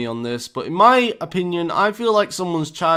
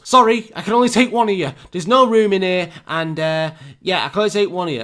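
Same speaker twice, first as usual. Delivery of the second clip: higher, louder, rushed driver brushing them off; put-on bus driver voice; 4 semitones higher, 5 dB louder, faster than usual. Bus driver turning away passengers, impatient raised voice. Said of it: "and the bus driver goes".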